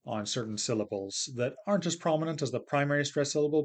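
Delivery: ordinary talk, nothing marked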